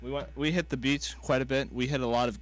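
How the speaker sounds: ordinary delivery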